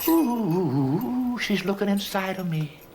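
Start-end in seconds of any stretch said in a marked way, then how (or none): 0.1-1.4 a long, high, sighing ooh; high swooning ooh. Acted. Boy swooning as the teacher looks inside him, high ooh.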